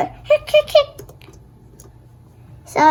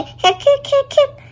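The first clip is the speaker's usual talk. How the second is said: squeaky hiccups; 11 semitones higher than usual. Acted. Girl hiccuping while listing other cures, high squeaks.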